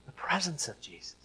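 breathy whisper of "The presence of Jesus.". Shown as a demonstration. Preacher whispering "The presence of Jesus." to the congregation. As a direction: hush it to a reverent whisper.

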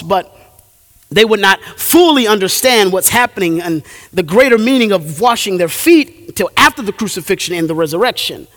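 ordinary talk

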